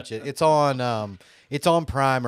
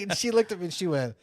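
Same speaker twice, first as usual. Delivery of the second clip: ordinary talk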